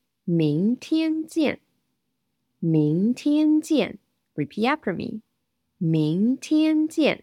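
"明天见" said slowly, each syllable clear; slow model pronunciation. Shown as a demonstration.

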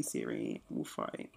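hushed, unvoiced warning; playful whispered menace; whispered mock threat.

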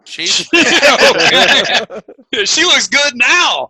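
loud laughing words. Real, not acted. Laughing loudly at the name.

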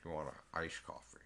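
low, soft mock voice; weird-talking impression. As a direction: lampoon his odd talk, low and quiet. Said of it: "He talks weird".

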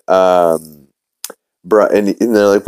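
ordinary talk

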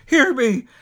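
high, loud, urgent pleading; desperate cry. A narrator's reading. Scrooge clutching the spirit's robe, desperate plea. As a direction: beg to be heard. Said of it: "he cried, tight clutching his robe".

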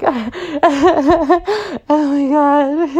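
very high, slow, laughing through it. Retold or imitated. To her mom, laughing high while stoned. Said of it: "And I was like".

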